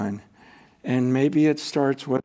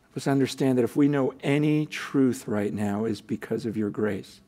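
closing prayer. Acted.

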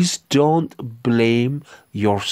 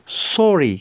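ordinary delivery

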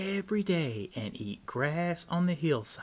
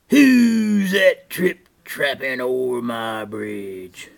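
slow, booming troll cry; angry troll; 12 dB louder, slower than usual. A narrator's reading. Troll challenges the goat crossing his bridge, angry booming cry. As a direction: roar it out slowly, drawing out the words. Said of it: "He shook his fists and cried".

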